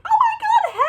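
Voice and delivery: very high, loud squealed hello; excited greeting. Retold or imitated. Running up to a girl she knows, squealing hello. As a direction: squeal it like meeting an old friend. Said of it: "run up to this girl that I knew and be like".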